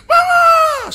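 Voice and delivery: put-on high, loud child's scream; crying for mama. Toddler locked in her room screaming for her mother. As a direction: shriek for your mother.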